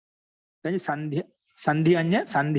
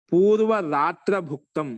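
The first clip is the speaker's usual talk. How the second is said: slow recitation; 2 semitones higher, 5 dB louder, much slower than usual. Shown as a demonstration. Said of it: "teacher recites compound form".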